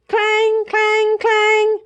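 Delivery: high, ringing clang of an alarm bell. A narrator's reading. Narrator voices the monster alarm bell ringing. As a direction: ring it out like a bell.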